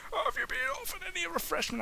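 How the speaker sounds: high, fast, soft polite query; high fast assistant. Acted. Assistant offers the heroine refreshment, high and quick.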